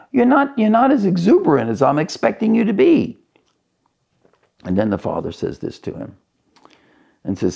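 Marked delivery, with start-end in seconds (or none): 0.1-3.2 very high, rushed complaint; acted indignant outburst, tripping over the start; upset son. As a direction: protest fast in a high, aggrieved voice. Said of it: "I'm upset".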